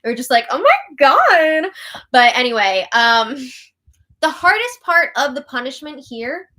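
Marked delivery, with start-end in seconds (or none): 0.5-1.7 loud, high, drawn-out mock shock; stretched, hammed-up horror; mock scandalized gasp. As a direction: act out being hugely scandalized. Recalls scandalized reaction to photo.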